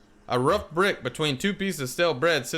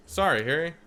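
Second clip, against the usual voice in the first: ordinary talk, nothing marked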